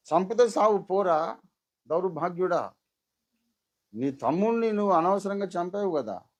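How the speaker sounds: loud, high scolding of a murderer; angry rebuke. Pretend. Preacher voicing God scolding Cain, loud and angry. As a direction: tell the killer off to his face, furious. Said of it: "అని అనాలి".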